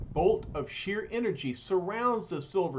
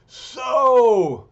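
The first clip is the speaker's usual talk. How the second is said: much louder, higher roar; hollering Thing; 9 semitones higher, 14 dB louder than usual. Acted. The Thing roars, finding his rival there. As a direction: bellow it out like an angry giant. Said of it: "hollers the Thing".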